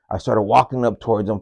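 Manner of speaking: ordinary talk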